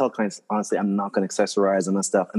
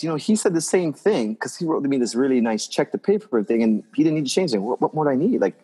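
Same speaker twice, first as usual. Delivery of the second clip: ordinary talk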